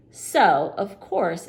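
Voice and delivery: ordinary delivery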